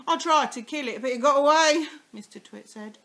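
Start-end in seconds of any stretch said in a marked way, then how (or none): 0.1-1.9 louder, higher mock alarm; sly husband scaring. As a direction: play up the danger. Mr Twit scaring wife.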